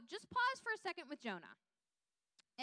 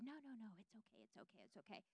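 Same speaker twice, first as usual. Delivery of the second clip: the devil cooing softly, much softer, to lull you; soothing devil; 15 dB quieter, faster than usual. Acted. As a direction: coax them like a sly tempter, almost breathing it. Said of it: "The devil will try to play with you".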